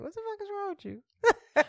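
mock high-pitched question, laughing through it; imitating the high inflection. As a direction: echo her squeaky disbelieving version, amused. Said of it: "you broke down everything I said with just the inflection".

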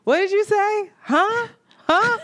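straining to catch his words, voice rising; confused high repeats. Retold or imitated. Asking the mechanic again and again, high and bewildered.

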